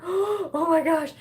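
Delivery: high, slow gasp of horror, acted out; aghast. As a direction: act out dawning alarm. Gasping as oil shoots everywhere.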